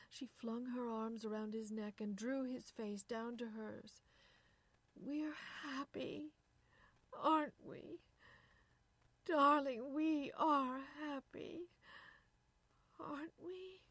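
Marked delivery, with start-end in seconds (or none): 4.9-13.8 high, slow, desperate appeal; anxious, drawn-out plea; pleading. A narrator's reading. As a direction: beg for reassurance, haltingly. Wife pleading for reassurance.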